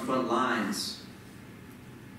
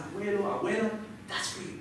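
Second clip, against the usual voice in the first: high, hushed-ending tribute to grandparents; moved dedication; 8 semitones higher, 5 dB quieter than usual. Retold or imitated. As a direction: retell a heartfelt dedication, pitched up and quickening. Speech to grandparents, retold.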